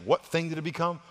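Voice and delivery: ordinary talk, nothing marked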